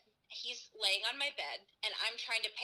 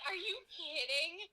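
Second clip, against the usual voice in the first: high-pitched, drawn-out mock disbelief; you cannot believe he asked that; incredulous; 8 semitones higher, slower than usual. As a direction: retell it as stunned exasperation, stretched out. Retelling her shock at boyfriend.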